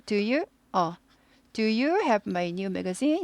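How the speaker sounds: slow, soft question climbing on magazine; rising question. Shown as a demonstration. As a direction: lift the end of magazine slowly. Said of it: "이렇게 올라갑니다". (magazine 끝을 천천히 올려 묻기)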